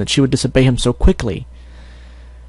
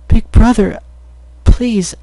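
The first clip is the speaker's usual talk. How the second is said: much higher, pleading little sister; a much higher child voice begging; tearful plea; 10 semitones higher, 4 dB louder, slower than usual. Acted. Sister pleads through tears.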